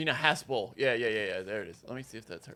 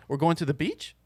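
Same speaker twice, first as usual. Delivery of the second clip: statement rising like a question at BEACH; uptalk; 6 dB quieter than usual. Shown as a demonstration. Showing how the woman doesn't talk, rising uptalk. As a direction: lift the pitch on "beach" as if asking. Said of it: "she doesn't talk like this".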